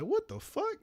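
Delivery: high, squeaky disbelief; shocked squeal. Retold or imitated. Friend shocked at his presence.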